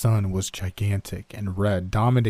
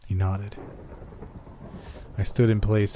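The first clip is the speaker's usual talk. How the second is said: usual speaking voice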